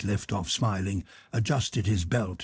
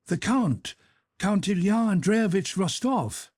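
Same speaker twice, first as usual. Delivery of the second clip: lightly voiced young officer, higher; 9 semitones higher than usual. A narrator's reading. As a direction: answer politely from outside. Officer answers at the gate.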